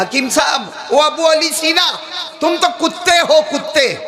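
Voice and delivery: high, ringing call-out; acted public insult. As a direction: call him out by name, then hurl the insult. Maulana hurls insult at hakim.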